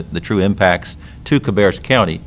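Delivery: ordinary talk, nothing marked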